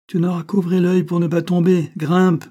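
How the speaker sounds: loud, high, impatient command; harsh order. A narrator's reading. Banquiste overrides the child's fear of falling, harsh order. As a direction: brush off the fear and order sharply.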